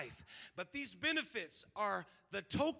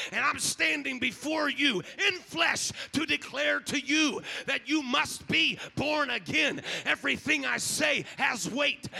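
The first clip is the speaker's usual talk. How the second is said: loud, higher preacher's declaration; full-force preaching; 4 semitones higher, 8 dB louder than usual. Acted. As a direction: proclaim it like a trumpet call.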